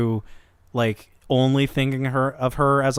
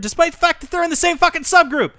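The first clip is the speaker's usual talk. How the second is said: high, rushed disbelief; incredulous exclamation; 12 semitones higher, faster than usual. Real, not acted. Host amazed at an unexpected pairing in one subgroup, incredulous. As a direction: race upward in exasperated amazement.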